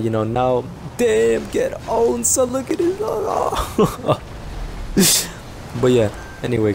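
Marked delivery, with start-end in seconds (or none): 1.0-3.0 high-pitched crowing taunt; gleeful gloating. Real, not acted. Gamer taunting a beaten rival, high gleeful gloating. 3.0-5.8 high bursting laughter; gleeful laugh. Real, not acted. Gamer laughing at a beaten rival, high gleeful cackle.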